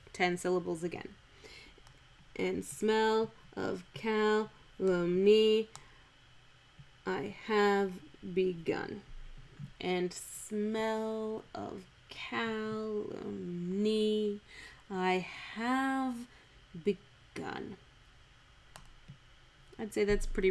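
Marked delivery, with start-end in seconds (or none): 2.4-9.0 slow, beat-by-beat verse reading; metrical scansion. Shown as a demonstration. Scanning the verse syllable by syllable. 9.8-17.8 softly drawn-out metrical reading; slow scansion. Shown as a demonstration. Scanning the verse syllable by syllable.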